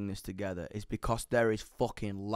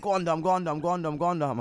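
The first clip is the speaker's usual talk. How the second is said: chanted, higher-pitched goading; rapid repeated peer-pressure chant; egging him on; 4 semitones higher than usual. Retold or imitated. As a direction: imitate a crowd of mates urging someone on. Schoolmates egging him on, retold.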